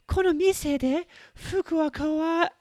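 put-on high falsetto girly voice; prettiest girl voice. Shown as a demonstration. Host demoing a lady's wa in falsetto. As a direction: play it as a sweet young lady. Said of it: "use my prettiest girl voice".

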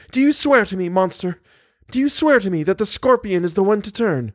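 high, pleading demand; high-pitched cry, demanding an oath. A narrator's reading. Christine demands the monster swear, crying out high. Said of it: "cried Christine".

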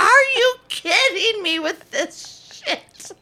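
exasperated outburst. Retold or imitated. Mom's exasperated outburst retold.

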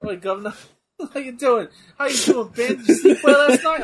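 high, loud hammed-up British greeting; mock British accent. Greeting a friend after landing in UK, mock British accent. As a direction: greet him in a wandering mock Cockney accent, on the edge of laughing. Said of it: "Your accents are all over the place".

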